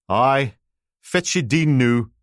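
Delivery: put-on old Aberdonian man, high and loud; thick Aberdeen brogue, calling out from the door; broad Aberdonian accent. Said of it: "Aberdonian accent you could plough fields with".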